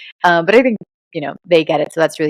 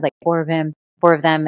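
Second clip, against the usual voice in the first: normal talking voice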